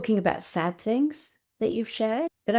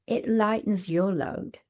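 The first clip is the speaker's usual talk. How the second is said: ordinary delivery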